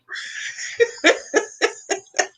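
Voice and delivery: high, breathless string of giggles; long laugh. Real, not acted. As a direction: laugh at your own story. Laughing at own story.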